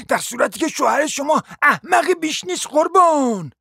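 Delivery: high, wailing indignant tirade; howling outrage. A narrator's reading. Lady stung by being called a barrel, howling indignantly. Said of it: "زوزه کشید و گفت".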